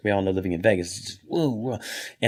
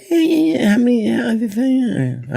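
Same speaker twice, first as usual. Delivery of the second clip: high mock Jay Leno voice, louder; imitating Jay Leno; Jay Leno impression; 13 semitones higher, 5 dB louder, much slower than usual. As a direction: squeak it out like Jay. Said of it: "he always says".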